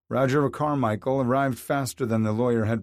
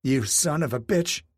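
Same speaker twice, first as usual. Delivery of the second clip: higher mock yell, lightly voiced; betrayed wife yelling at her husband; 4 semitones higher than usual. A narrator's reading. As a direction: hurl the insult. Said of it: "Rebecca yelled".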